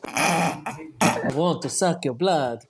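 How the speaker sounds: slow, hammed-up vampire accent; badly faked Hungarian-ish vampire accent. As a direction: draw it out in a dodgy vampire accent. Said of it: "Very bad vampire accent".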